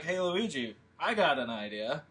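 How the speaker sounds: normal talking voice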